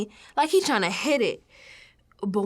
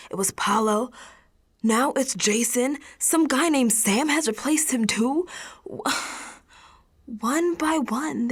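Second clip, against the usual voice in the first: ordinary delivery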